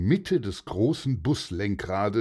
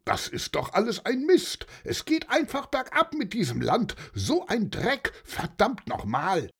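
grumbling rant; 11 semitones higher than usual. A narrator's reading. Angry driver cursing at his broken car. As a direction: spit the curses out, fed up. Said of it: "hörte man ihn schimpfen".

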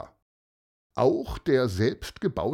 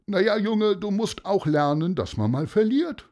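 lightly voiced know-all bystander, pitched up; lecturing onlooker; 10 semitones higher, 4 dB louder than usual. A narrator's reading. Onlooker tells boy to accept losing, lecturing. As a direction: give the boy a patronising lesson.

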